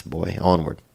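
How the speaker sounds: ordinary delivery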